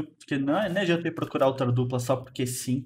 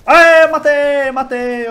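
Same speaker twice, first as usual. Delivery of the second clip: high, loud victory yell; real gamer triumph shout; excited cheer; 10 semitones higher, 11 dB louder, much slower than usual. Real, not acted. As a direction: shout it out like you just got the kill. Kill in game, cheering.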